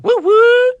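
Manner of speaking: high, loud woot woot squeal; excited cheer. Retold or imitated. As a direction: cheer it like a hyped fan. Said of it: "That means she's excited".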